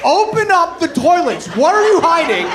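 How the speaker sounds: indignant shout at the locked bathrooms; ranting demand. Acted. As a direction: yell it at the whole city.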